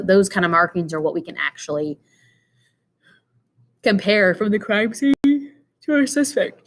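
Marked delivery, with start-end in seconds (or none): 3.8-6.7 talking through a yawn; sleepy yawn mid-sentence. Real, not acted. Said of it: "I'm still yawning".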